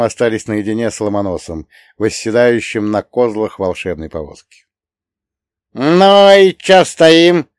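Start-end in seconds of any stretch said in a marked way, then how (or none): 5.7-7.5 loud, slow, high cheeky call; rude. A narrator's reading. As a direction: demand it impatiently and rudely. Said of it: "совершенно непочтительно вопросил".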